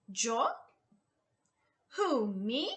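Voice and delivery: ordinary talk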